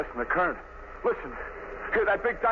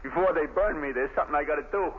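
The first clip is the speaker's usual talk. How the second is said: ordinary talk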